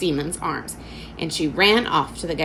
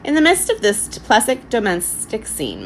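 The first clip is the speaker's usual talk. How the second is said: ordinary delivery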